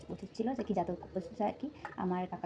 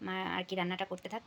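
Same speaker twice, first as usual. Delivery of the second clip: ordinary talk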